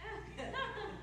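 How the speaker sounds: a soft, high chuckle; amused laugh. Real, not acted. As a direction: laugh at his own joke. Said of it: "Pretty good, isn't it?".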